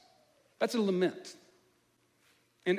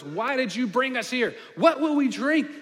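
angry crowd cry; 7 semitones higher, 6 dB louder than usual. Retold or imitated. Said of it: "They cry out against Moses".

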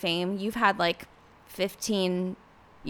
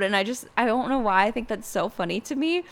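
ordinary talk

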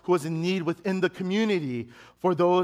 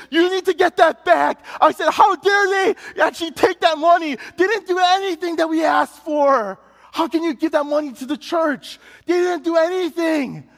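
much higher and louder indignant shout; furious, demanding the money back; outraged; 10 semitones higher, 6 dB louder than usual. Retold or imitated. Son telling his mom to get the money back, outraged. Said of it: "And I was outraged".

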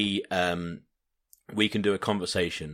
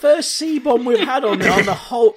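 mock shock; 10 dB louder than usual. Real, not acted. Exclaims about the first swear word.

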